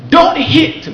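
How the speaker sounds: high, loud barked order; mock the father yelling at his son; imitated shout.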